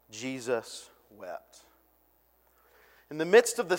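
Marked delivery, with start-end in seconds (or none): 0.1-1.6 very slow, low and soft; hushed weight. Acted. Preacher reading the shortest verse, slow and hushed. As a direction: let the verse fall quietly.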